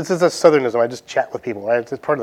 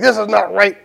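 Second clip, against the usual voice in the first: sharp, raised, higher accusing line; mock angry complaint; 6 semitones higher than usual. Customer slamming food down at the drive-thru, mock angry. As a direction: slap it down and snap the complaint. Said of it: "you slam the food down on the counter and be like".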